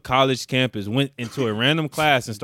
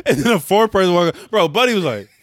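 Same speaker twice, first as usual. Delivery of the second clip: normal talking voice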